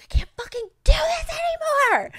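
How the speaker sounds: retold crying shout; breaking down. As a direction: wail it, falling apart. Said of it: "And I was like".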